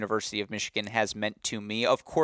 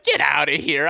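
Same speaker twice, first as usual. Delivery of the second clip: higher, louder, beaming get outta here; brushing off the apology, thrilled; delighted disbelief; 5 semitones higher, 6 dB louder than usual. Retold or imitated. Host replays his hug for the coach, higher and louder with joy. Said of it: "I was like".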